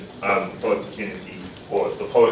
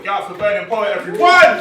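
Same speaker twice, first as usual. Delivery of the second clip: rising to a loud, much higher shout on everyone; hype call-out; 9 semitones higher, 6 dB louder than usual. Real, not acted. Host rallying the audience for Burning Poet, loud rising shout. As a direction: whip the crowd up for Burning Poet.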